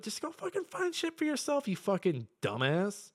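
high mock rant at gamers. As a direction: scream it up high like an exasperated ranter. Ranting at gamers.

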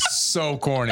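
chuckling through the words; laughing. Real, not acted. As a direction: laugh while saying it. Laughing at joke.

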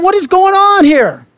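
mock disbelief. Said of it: "You're going".

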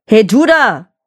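loud, rough bark at a stranger; rude shout. A narrator's reading. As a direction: bellow it rudely. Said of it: "brüllte er den Apostel unhöflich an".

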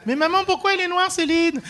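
put-on high-pitched little kid asking mum; puzzled child. Voices a child in audience.

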